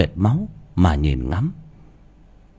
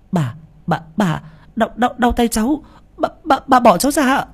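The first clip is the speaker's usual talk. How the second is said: pleading in pain; 12 semitones higher than usual. Acted. Child begging grandma to let go.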